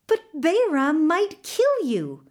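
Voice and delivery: high, urgent alarm; worried protest. A narrator's reading. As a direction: plead with a friend not to risk it. Fears for his friend's life.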